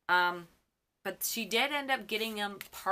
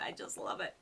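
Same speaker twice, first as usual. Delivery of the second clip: whispered gush; 7 dB quieter than usual. Real, not acted. Whispered after the squeal.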